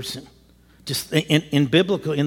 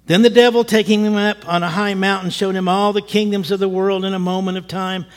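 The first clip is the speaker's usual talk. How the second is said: ordinary talk, nothing marked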